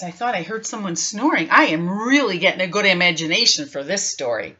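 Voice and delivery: plain reading voice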